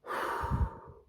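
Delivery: big sigh to hold off tears; heavy sigh. Real, not acted. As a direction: blow out a loud, steadying breath. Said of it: "I don't wanna cry".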